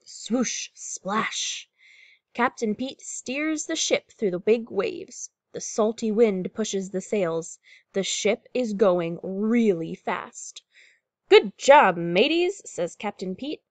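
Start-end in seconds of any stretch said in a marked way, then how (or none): 0.0-1.7 hushed, whooshing water sounds; storybook sound effects. A narrator's reading. Story sound words performed. 11.3-12.6 loud, higher-pitched cheer to the crew; storybook captain cheering the crew on; captain's hearty praise. A narrator's reading.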